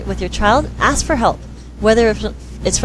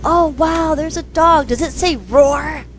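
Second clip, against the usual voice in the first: high, slowed silly question; mock wonder; 8 semitones higher than usual. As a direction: play dumb to a child. Playing dumb with book.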